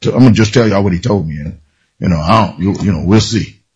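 mock a preacher who isn't sure of his message; half-hearted low mutter of a hesitant messenger; mumbled hedge. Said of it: "he wasn't saying it like".

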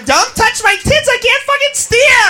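put-on high-pitched party-girl yell; acted squealing protest; shrieking girl driver. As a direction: scold rowdy passengers while driving.

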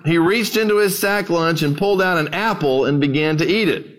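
normal talking voice

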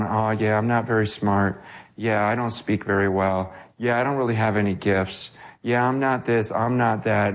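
low, mildly glum false humility; mock self-belittling. Preacher mimicking his old humble phase, mock self-belittling. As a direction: put yourself down in a low monotone. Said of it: "we need to belittle ourselves".

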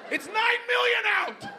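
high, slow squealing shout; high mock woman shout. High-pitched mock shout back.

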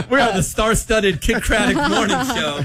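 high-pitched, laughing show intro; hammed-up radio intro through laughter; mock announcement. Mock show announcement after a joke.

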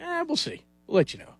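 high and hushed, shrugging it off; dismissive brush-off. Retold or imitated. Team brushing off the eager rookie, dismissive. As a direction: brush him off lightly. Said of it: "they're like".

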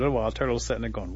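ordinary delivery, nothing marked

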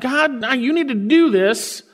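mock testing tone; demanding. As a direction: insist God prove himself.

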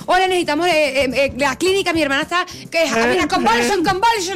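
high, stumbling call to 911 in broken English. Retold or imitated. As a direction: fumble for the English word on the phone. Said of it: "no sabían cómo era la palabra en inglés". (llamada nerviosa en inglés chapurreado)

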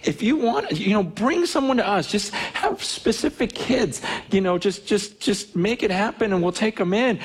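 informal, imploring prayer; retold prayer. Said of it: "We prayed. We were like, 'God".

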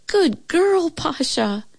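high-pitched pet-praise voice; praising a pet. A narrator's reading. Shirley praises her well-behaved dog, high and fond. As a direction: coo approval to a dog, starting very high.

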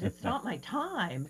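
slow, incredulous repeat; can't believe what she heard; disbelieving echo. Retold or imitated. Woman echoing Jesus in slow disbelief.

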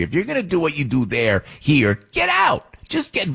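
rising angry rant ending in a shout; real outburst, a shout at the end. Real, not acted. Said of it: "Forgive me for ranting".

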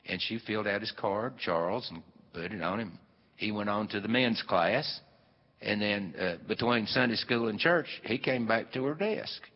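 ordinary talk

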